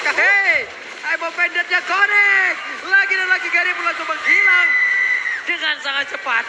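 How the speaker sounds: loud, high caster hype call; excited play-by-play. Real, not acted. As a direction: call the kill like a goal. Caster hypes a sudden kill.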